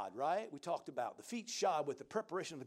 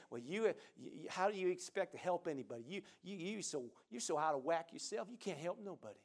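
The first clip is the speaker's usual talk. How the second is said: a hint of a sneering devil, lower and much softer; devil's taunt; 3 semitones lower, 7 dB quieter than usual. Devil whispering doubt to a believer, soft sneering taunt. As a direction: taunt them quietly like the accuser. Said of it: "if the devil ... he'll convince you, say".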